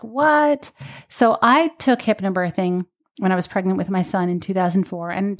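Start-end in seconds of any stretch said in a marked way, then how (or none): 0.0-0.7 a high, startled "What?"; mock disbelief. Hearing that women were conditioned to push, a high disbelieving "What?". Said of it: "And I'm just like".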